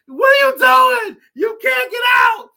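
very high, loud disbelieving shout; shrieking what are you doing; frogs shouting. Acted. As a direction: yell at him in disbelief. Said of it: "And it's like".

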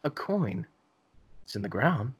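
a hint of a narrator wondering aloud to himself; mock pondering narrator. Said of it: "It's like".

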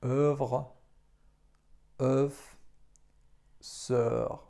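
"Œuvre", "œuf", "sœur" with a rounded French EU; teacher showing the OE ligature; French pronunciation. Shown as a demonstration. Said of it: "Wymawiamy to jako E".

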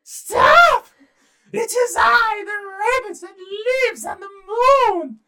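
high, loud squeaky little rabbit shout; tiny rabbit's shrill command, words drawn out. Acted. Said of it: "said in his rabbit voice".

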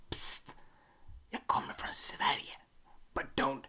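whispered confession in Swedish; secret whisper. Pretend. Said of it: "ingen får höra det".

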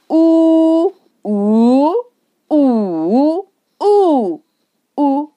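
"五" level, rising, dipping, falling, short; Mandarin tone drill. Shown as a demonstration. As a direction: run "五" through all five tones in order. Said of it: "I put different tones on them".

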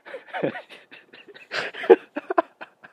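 helpless high cackling laugh; loud breathy laughing fit; bursting out laughing. Real, not acted. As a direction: crack up at your own story. Cracks up at own story.

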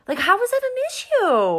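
high, loud disbelief; how can this even be; incredulous. Real, not acted. Outraged rant about racists.

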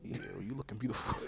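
very soft whispered greeting; hushed mock-intimate check-in; ASMR whisper. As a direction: whisper it close, playing at ASMR. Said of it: "A S M R part three".

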